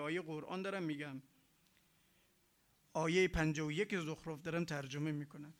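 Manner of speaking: ordinary talk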